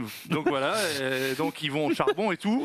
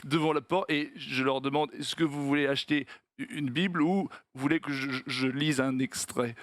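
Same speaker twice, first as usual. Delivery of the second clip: low, halting stammer; stuttering salesman; 4 semitones lower than usual. Acted. Stutterer in a joke offers Bibles at the door, stammering. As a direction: trip over small words, repeating them. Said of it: "au mec qui bégait".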